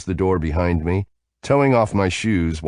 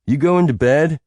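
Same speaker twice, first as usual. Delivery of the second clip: raised voice, calling upstairs; 10 semitones higher, 5 dB louder than usual. A narrator's reading. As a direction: call it up the stairs. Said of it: "he called out".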